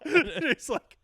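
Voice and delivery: laughing through the words; giggling disbelief. Real, not acted. Laughing while quoting.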